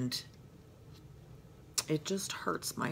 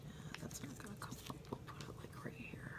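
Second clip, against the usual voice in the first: whisper; 8 semitones higher, 17 dB quieter, much faster than usual. Real, not acted. Murmuring while crafting.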